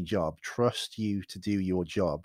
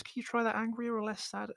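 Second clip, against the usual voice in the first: high, put-on voice of a fussy director, softer; mock director voice; 13 semitones higher, 5 dB quieter than usual. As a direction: lampoon a director giving an acting note. Mimicking a director's note.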